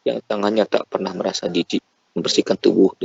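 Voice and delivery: ordinary talk, nothing marked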